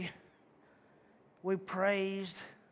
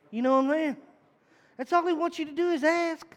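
loud, higher preaching climax; preaching shout; 9 semitones higher, 7 dB louder than usual. Real, not acted. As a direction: lift it and belt it to the congregation.

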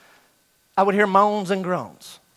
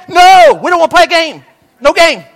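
loud, high shouted refusal; students shouting; 9 semitones higher, 12 dB louder than usual. Retold or imitated. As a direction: refuse with all their breath.

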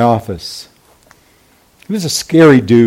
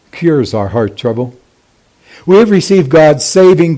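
usual speaking voice

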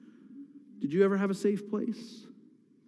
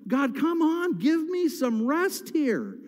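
rising, pleading cry of frustration; exasperated plea to God; 5 semitones higher than usual. Retold or imitated. Pastor voicing David crying out to God, exasperated. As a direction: shake your fist at God and beg for a break. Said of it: "I'm shaking my fist at God, saying".

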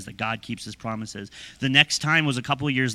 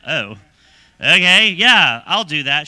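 eager agreement; 3 semitones higher, 10 dB louder, slower than usual. Retold or imitated. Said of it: "And I went".

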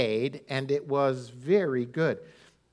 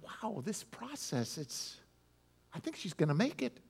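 soft private thought; 2 semitones higher, 12 dB quieter than usual. Retold or imitated. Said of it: "But I'm like".

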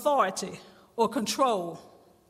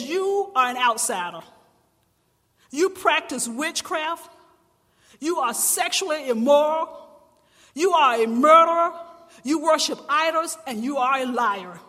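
stern accusation; 2 semitones higher, 7 dB louder than usual. Acted. Preacher voicing Jesus' accusations.

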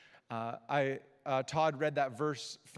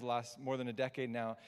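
usual speaking voice

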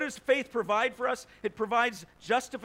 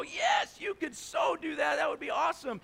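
higher, mock cheering crowd; eager crowd; 6 semitones higher than usual. Crowd cheers the walker on, eagerly agreeing, higher. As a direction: cheer him on like an excited crowd. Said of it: "they all go".

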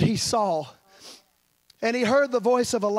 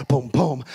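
low double thump; low-pitched pum-pum pulse; imitated heartbeat; 9 semitones lower, 4 dB louder than usual. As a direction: voice a deep heartbeat in two beats. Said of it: "hear that heartbeat".

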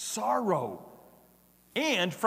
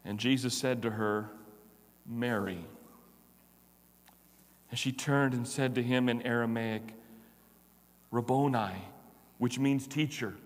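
lower, measured Bible reading; lower-pitched solemn reading of scripture; 6 semitones lower than usual. Acted. Reading the Gospel passage aloud.

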